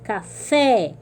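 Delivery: café com o FÉ forte; última sílaba forte. Shown as a demonstration. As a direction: stress the final syllable of café. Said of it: "têm a última sílaba forte". (café, com a última sílaba bem marcada)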